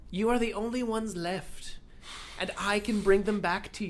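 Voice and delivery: lightly voiced villain offering a deal; dark lord. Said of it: "This is such a good impression".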